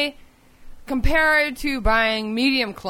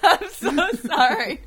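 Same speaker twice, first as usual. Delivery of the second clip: laughing; 6 semitones higher, 4 dB quieter, slower than usual. Real, not acted. Still laughing after a joke.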